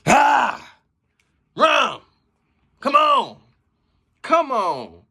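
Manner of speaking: loud, high hype shouts; excited yelling at a great bar; hyped shouting. Real, not acted. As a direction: cheer the rapper on like a fan at ringside. Hyping up a rapper's bar.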